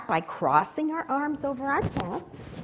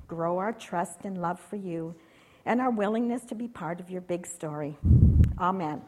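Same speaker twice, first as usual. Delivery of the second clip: prayer; 4 semitones lower than usual. Real, not acted. Leader praying with children before acting out the story, prayerful.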